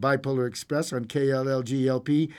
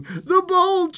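high, panting shout; loud strained cry; 7 dB louder than usual. A narrator's reading. Old woman at the bolt calling her husband, loud strained cry. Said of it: "she cried loudly".

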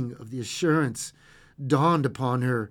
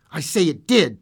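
firm, louder insistence; insistent; 5 semitones higher, 10 dB louder than usual. A narrator's reading. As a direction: insist on it, loud. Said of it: "replied the other".

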